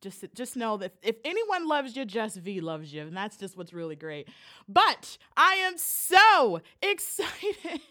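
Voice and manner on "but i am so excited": drawn-out, loud, laughing "so excited"; giddy excitement. Real, not acted. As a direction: stretch it with a laugh in the middle. Hyping the guest's arrival.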